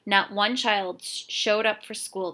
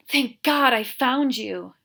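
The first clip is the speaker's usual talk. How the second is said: sighing; 5 semitones higher, 8 dB louder, slower than usual. A narrator's reading. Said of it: "she sighed".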